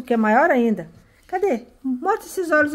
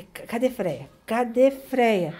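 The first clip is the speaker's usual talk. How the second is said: ordinary talk, nothing marked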